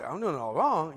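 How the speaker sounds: a muted, stunned echo of the insult; deflated echo. Retold or imitated. And I'm like.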